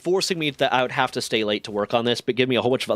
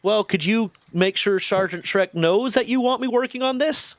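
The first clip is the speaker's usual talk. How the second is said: innocent younger self; 9 semitones higher than usual. Retold or imitated.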